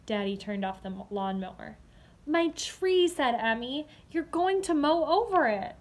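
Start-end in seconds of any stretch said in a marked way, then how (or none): 2.3-3.1 higher, louder cry of worry; girl panicking about her tree; alarmed child. Acted. Emmy alarmed. 4.1-5.8 higher, louder cry of worry; girl panicking about her tree; alarmed child. Acted. Emmy alarmed.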